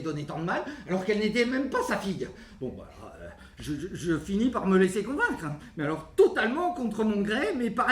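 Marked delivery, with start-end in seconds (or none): none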